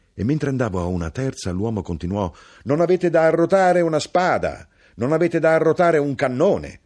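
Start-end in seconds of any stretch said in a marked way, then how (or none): 2.6-6.8 high, loud, mocking hawker; a strange birdlike street crier; loud, high hawker listing his wares; knife-grinder's call.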